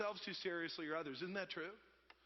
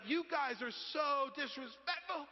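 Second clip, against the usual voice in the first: slow, high-pitched mock indignation; hammed-up scolding; imitated scolding trainer; 6 semitones higher, 3 dB louder, slower than usual. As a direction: draw out an offended telling-off. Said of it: "the trainer looks at us like".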